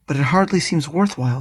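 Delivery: ordinary delivery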